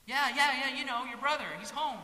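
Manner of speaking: high and loud, rushing yes yes; eager servant. Acted. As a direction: beam the news out again. Servant confirms to older son.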